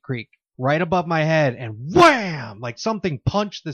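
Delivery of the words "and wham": sound effect wham. Retold or imitated. Imitating a punch on the ceiling.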